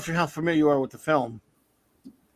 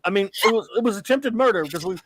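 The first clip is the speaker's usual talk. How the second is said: ordinary talk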